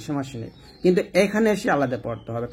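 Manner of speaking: ordinary delivery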